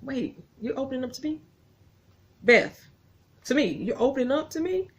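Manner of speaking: rising disbelief, voice cracking up high; can't believe she's opening up; incredulous. Retold or imitated. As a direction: Kevin stunned that Beth confides in him. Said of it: "Kevin's looking at her like".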